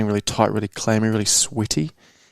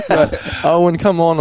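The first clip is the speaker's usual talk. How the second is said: higher and louder through laughter; laughing; 8 semitones higher, 6 dB louder than usual. Real, not acted. Laughing as he protests to his friend. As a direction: talk while still laughing.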